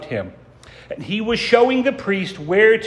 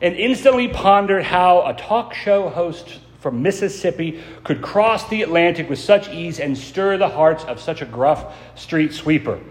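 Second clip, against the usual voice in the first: ordinary talk, nothing marked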